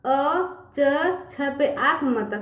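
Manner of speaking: अ च छ sounded out slowly, one letter at a time; teacher spelling out अ च छ slowly for children, letter by letter; syllable by syllable. Shown as a demonstration. Sounding out letters. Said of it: "जब इसको पूरा पढ़ेंगे ना".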